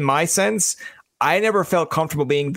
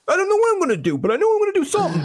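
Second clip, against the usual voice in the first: high, fast, cartoonish take on young Greg; squeaky mock student voice; host teasing guest with a kid voice; faster than usual.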